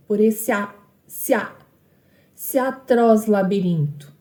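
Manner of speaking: "esse a" and "se há" glided into one syllable, sya; elided syllables. Shown as a demonstration. Teacher showing how vowels join in "se atroz", linked as one syllable. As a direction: run the final e of "esse" into the a of "atroz". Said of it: "essas duas sílabas se juntam".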